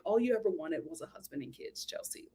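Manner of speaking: muttered aside. Retold or imitated. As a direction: mutter it dryly to Chelsea. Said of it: "I'm like".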